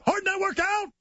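high hyped-up show call; excited shout. Acted. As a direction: yell it like a radio sting.

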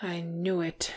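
softly mumbled regret. A narrator's reading. Dwarf realising he was right about his friend, mumbled. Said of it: "mumbled Bombur".

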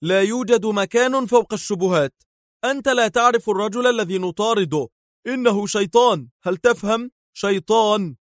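high-pitched, vehement outburst; incensed insistence, driving the point home; heated anger. A narrator's reading. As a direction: argue furiously, pitch held high. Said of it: "وهو يقول محتدا".

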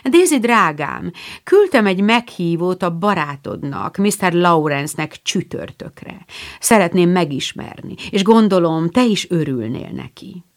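usual speaking voice

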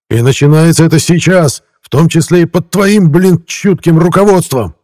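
high, loud, building angry tirade; voice gaining strength. A narrator's reading. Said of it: "голос его набирал силу". (гневная обличительная речь, всё громче)

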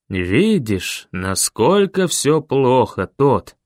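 ordinary delivery

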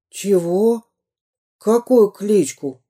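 put-on a bewildered little boy; high puzzled child; confused child. A narrator's reading. Child voiced in story.

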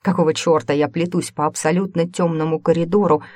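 ordinary delivery, nothing marked

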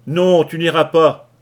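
loud, slow yell. Acted. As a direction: bellow to block his way. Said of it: "hurla Kelly". (hurlement fort et lent)

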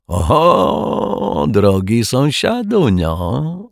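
high, drawn-out jovial greeting; joyful announcement. A narrator's reading. Tipsy rogue hails his neighbour, joyfully. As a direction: swoop up high and stretch the welcome. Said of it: "obwieścił radośnie Martwiak".

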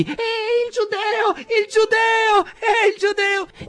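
very high, slow, drawn-out taunting cries; jeering street boys. A narrator's reading. Street boys jeer at Rothschild in very high, slow taunting shouts. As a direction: chant it like mocking kids. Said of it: "con gridi di".

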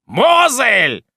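high, loud summons; loud high call. A narrator's reading. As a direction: call the servant across the house. Magistrate calls the servant.

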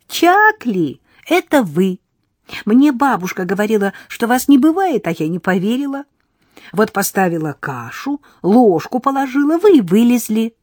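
put-on high, loud childish girl voice; little girl lecturing the newcomers; cheeky little girl. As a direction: play a bold village girl.

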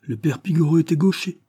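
scornful superiority; pitying disdain. A narrator's reading. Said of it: "nous toisant d'un air de pitié".

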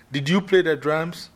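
high, loud stern elder question. Shown as a demonstration. Queen Mother rehearsing, sternly questioning the player. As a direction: demand an answer.

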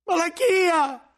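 very high, loud, slow desperate cry of the name; anguished scream. Acted. Cellarer screams at the librarian for betraying him. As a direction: scream his name in panic. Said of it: "urlò il cellario".

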